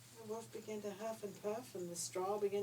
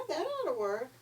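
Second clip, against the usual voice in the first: little pig; 8 semitones higher, 8 dB louder than usual. A narrator's reading. Second pig voiced in story.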